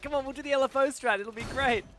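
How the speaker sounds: eager teammate. Retold or imitated. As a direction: talk the teammates into it. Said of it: "We said, like".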